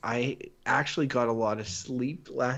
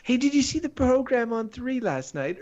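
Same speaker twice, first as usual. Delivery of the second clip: mock TV viewer; 11 semitones higher, 3 dB louder than usual. New Zealand viewer mocked.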